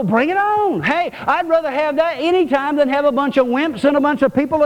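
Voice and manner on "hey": ordinary talk, nothing marked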